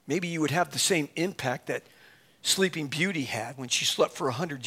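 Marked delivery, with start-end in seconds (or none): none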